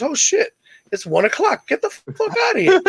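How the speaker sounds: high-pitched mock astonishment; exaggerated joke-telling amazement; amazed cowboy. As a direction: act out delighted disbelief, voice leaping up.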